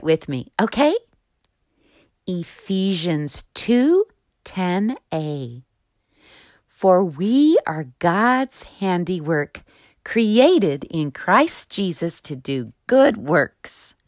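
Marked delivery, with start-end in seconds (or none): 2.3-13.9 slow, clear memory-verse reading; slow recitation. Shown as a demonstration. Host reciting Bible verse first for children, slow and clear. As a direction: recite slowly so children can follow. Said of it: "I'll say it first".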